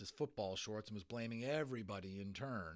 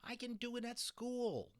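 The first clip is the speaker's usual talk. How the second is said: put-on whiny teen; 14 semitones higher than usual. Gillian pleading.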